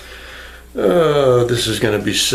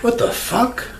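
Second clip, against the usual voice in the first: high startled what the fuck; surprised; 11 semitones higher than usual. Acted. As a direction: squeak it out in mock shock. Reacting to wardrobe change.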